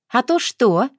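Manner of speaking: high, cocky taunt; smirking dare. A narrator's reading. Nika smirking back at Tyoma's threat, cocky. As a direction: challenge him with a smirk. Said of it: "ухмыльнулась Ника".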